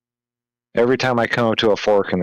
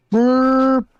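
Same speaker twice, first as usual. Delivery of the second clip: fake burp. Says burp after sorry.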